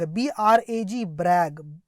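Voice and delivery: ordinary talk, nothing marked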